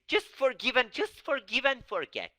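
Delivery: high, loud, hurried brush-off; dismissive parent. Retold or imitated. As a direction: wave it away as fast as you can. Mocking parents' forgive and forget.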